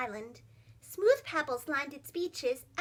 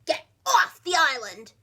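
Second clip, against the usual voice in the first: loud bossy shout; 9 dB louder than usual. Acted. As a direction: order them off at full volume. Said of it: "shouted Rupert".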